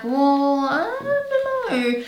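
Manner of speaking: high, drawn-out mock cluelessness; exaggerated bafflement. Vlogger quoting her reply about who owns the brand, baffled. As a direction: stretch it out high, as if at a total loss. Acting out her own puzzled reaction.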